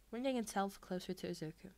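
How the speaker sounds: usual speaking voice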